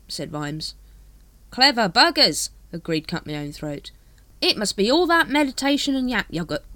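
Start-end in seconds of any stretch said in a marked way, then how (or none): 1.5-2.5 high, loud cockney-ish hawker; street hawker voice. Acted. Dibbler greets the Captain, high hawker voice. 4.4-6.6 high, loud cockney-ish hawker; street hawker voice. Acted. Dibbler greets the Captain, high hawker voice.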